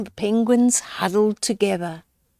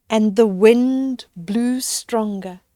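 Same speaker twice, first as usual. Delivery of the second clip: plain reading voice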